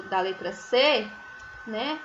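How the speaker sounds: ordinary talk, nothing marked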